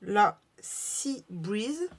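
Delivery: "Sea Breeze" drawn out very slowly in a French accent; French-accented English. Real, not acted. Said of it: "Désolée pour mon anglais".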